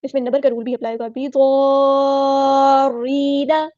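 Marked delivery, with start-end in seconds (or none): 1.1-3.7 slow melodic chant, drawn out; Quran recitation. Shown as a demonstration. Teacher models the verse for students, chanting. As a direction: intone the verse like a qari.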